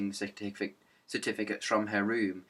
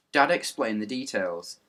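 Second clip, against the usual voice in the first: plain reading voice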